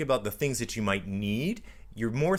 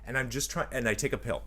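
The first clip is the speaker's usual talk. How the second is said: ordinary talk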